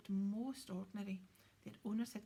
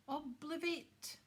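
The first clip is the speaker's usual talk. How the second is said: crisp, slow, higher incantation; sharp spell; 7 semitones higher, 4 dB louder, slower than usual. A narrator's reading. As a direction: snap the spell out, clipping each syllable. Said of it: "he said sharply".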